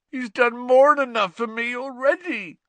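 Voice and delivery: high, loud anguished groan. A narrator's reading. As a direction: wail it in misery. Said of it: "groaned Hagrid".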